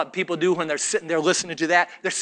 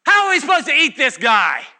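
loud, high incredulous protest; upset crowd; 9 semitones higher, 8 dB louder than usual. Retold or imitated. Upset crowd mocking Jesus's words, loud and incredulous. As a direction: scoff in loud disbelief. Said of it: "They are getting upset".

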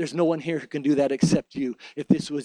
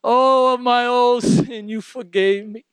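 high, louder, slow cry of grateful joy; overjoyed thanks; 6 semitones higher, 5 dB louder, slower than usual. Acted. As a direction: cry out in joyful relief. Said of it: "and you say".

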